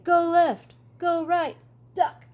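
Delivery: high, loud barked orders; commanding shout. Acted. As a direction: call the moves to the team.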